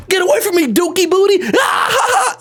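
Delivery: high, mocking squeal into a cackle; hammed-up kid taunt; jeering older kid. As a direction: shoo away in disgust and mockery. Acted older kids jeering.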